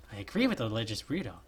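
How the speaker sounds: ordinary delivery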